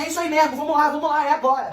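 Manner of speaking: high-pitched egging-on chant; rowdy crowd. Acted. Gang in the corridor heading to a fight, rowdy egging-on. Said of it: "uma galera falando assim".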